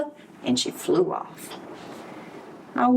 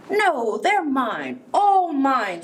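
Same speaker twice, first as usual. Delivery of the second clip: storybook scream; 7 semitones higher, much slower than usual. A narrator's reading. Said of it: "screamed the grouchy ladybug".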